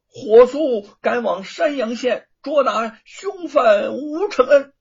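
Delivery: startled, loud, stammering command; flustered order. A narrator's reading. Frightened prefect hastily shouts for his men. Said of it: "范谷一哆嗦".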